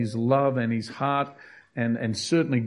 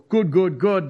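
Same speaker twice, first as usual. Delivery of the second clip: louder, higher mock cry of good; mock shout; 6 semitones higher, 4 dB louder than usual. Preacher mocking a wrong chant, louder and higher. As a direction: blurt good good good. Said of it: "They're not saying".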